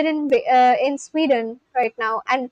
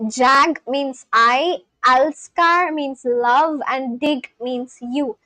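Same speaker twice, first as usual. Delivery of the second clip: slow, teacherly word by word; slow explaining; much slower than usual. Shown as a demonstration. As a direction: explain each word slowly to a friend. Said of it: "explains Swedish phrase word by word".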